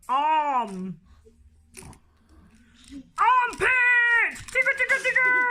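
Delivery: loud, high excited squeals; excited shouting. Acted. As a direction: shout it in wild glee. Excited loud tickle game.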